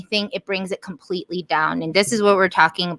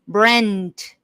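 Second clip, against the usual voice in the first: ordinary delivery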